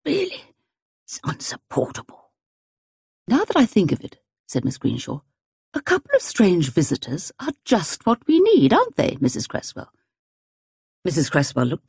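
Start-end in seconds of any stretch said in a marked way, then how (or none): 0.0-2.3 soft, breathy indignant murmur; murmured under breath. Acted. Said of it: "murmured below her breath". 3.3-9.9 put-on lightly raised, proud elderly spinster; a hint of a sharp old woman; old lady voice.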